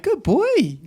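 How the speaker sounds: high-pitched dog-praising coo; coaxing praise. Acted. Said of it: "trying to use positive reinforcement".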